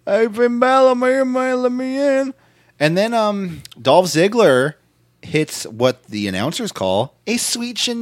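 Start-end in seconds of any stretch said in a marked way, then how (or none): 0.1-2.3 high, loud, strained character voice; Fiend impression. The Fiend shows up demanding Finn Balor, high menacing mimicry. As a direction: taunt him in a high put-on voice.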